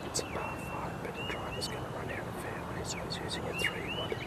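hushed TV golf commentary; golf commentator whisper. Acted.

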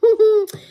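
high, loud burst of disbelieving laughter. Real, not acted. Laughing at her son's new crack, loud burst. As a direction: laugh at how bad it got.

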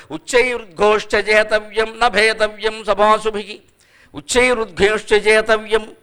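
slow, measured Sanskrit chant; slow chanted recitation of ఉచ్చైరుద్ఘోష్ట జేతవ్యం; verse recitation. Shown as a demonstration. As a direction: recite the verse slowly, weighing each word.

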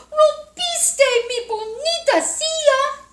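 high, loud, slow whiny wail; angry baby bear. Acted. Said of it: "gritó bebé osito". (osito enojado gritando)

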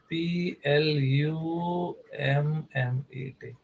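teacher spelling for notes; slow spelling out. Shown as a demonstration. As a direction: spell plummet letter by letter, very slowly. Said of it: "plummet".

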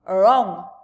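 loud giant cry; one big projected word; cried out. Acted. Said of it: "cried the giant".

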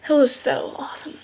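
breathy whispered delight; whispering. Real, not acted. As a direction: whisper it in awe. Whispered after cheer.

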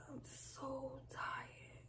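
drawn-out tired whisper; exhausted whisper. Real, not acted. Telling viewers how tired she is, slow exhausted whisper. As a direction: stretch it out, completely spent.

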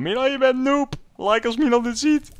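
high, loud mocking voice; mocking commenters. Viewers taunting player in the comments, high mockery. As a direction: squeal it like jeering viewers. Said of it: "Laat de reacties maar komen".

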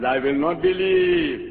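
slow, firm, dug-in refusal; stubborn refusal. Acted. Doubting Thomas refusing the ten disciples, slow and stubborn. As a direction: plant your feet and refuse.

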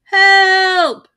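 high, loud cry for help; yelling for help. A narrator's reading. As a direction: shout it dangling upside down. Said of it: "he yelled".

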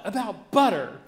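put-on outraged kid voice; indignant child. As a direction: throw it at her like proof of a crime. Child accusing mother in kitchen.

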